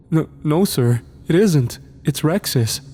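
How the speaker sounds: a hint of a timid boy; lightly voiced kid. A narrator's reading. Scared boy explaining to the angry man, lightly voiced.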